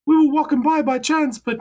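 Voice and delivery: put-on high, loud old Danish lady voice; an old Danish woman scolding in a high Danish accent. Old Danish woman catching a stranger at the grave, in a high Danish accent. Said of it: "Doing my Danish accent".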